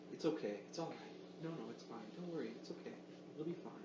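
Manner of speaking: soft, gentle soothing murmur; a quiet, gentle reassurance. Retold or imitated. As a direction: hush her like a grandmother calming a child. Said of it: "soft, gentle tones, soothing".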